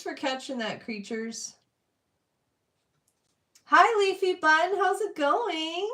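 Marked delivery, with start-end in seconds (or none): 3.7-5.9 higher and louder, second line slowed; excited greeting. Real, not acted. Streamer welcoming a chatter, bright and excited. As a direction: beam a welcome to a new face.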